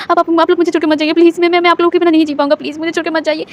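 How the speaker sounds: fast, breaking, crying plea; tearful pleading. A narrator's reading. Wing clings to his father, crying and begging him to stay. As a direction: rush the begging through sobs. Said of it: "wing लगातार रोए जा रहा था".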